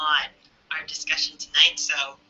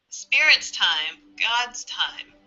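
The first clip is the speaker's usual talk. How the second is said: ordinary talk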